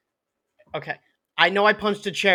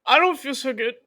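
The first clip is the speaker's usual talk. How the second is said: mock woozy regret; 7 semitones higher than usual. Voices feeling after rage is gone.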